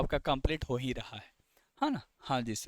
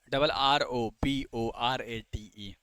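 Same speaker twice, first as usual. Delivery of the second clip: slow spelling; 6 semitones lower, much slower than usual. Shown as a demonstration. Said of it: "spelling a word for students".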